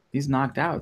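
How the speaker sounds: ordinary talk, nothing marked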